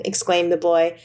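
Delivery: plain reading voice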